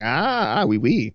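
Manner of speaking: playful mock-French "oui, oui". Co-host plays along about the French, mock-French accent. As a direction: put on a light French accent. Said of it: "that's how the French talk".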